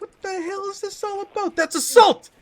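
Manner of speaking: high, indignant yelp; outraged reporter. Acted. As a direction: squeal out the outrage after the punch. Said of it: "He's like".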